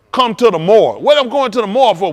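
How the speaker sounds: very loud, dramatized phone exchange; acted loud alarm. Parent told to come to the morgue, acted loud protest. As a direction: act it out at full volume, demanding to know why.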